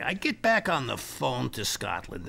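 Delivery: usual speaking voice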